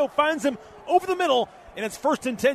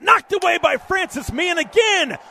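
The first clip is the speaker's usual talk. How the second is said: excited; 6 semitones higher, 5 dB louder than usual. Real, not acted. Pass breakup called.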